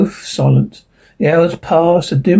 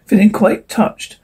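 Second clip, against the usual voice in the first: plain reading voice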